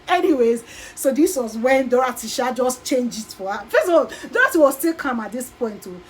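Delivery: ordinary talk, nothing marked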